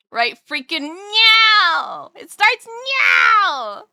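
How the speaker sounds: loud, high, drawn-out holler; playful over-the-top shout; hyped mock yell. Hyped answer about stream start.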